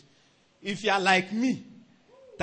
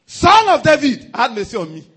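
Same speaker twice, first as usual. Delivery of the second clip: retold cry for mercy; 5 semitones higher, 13 dB louder than usual. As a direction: cry out to be heard over the noise. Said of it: "He shouted".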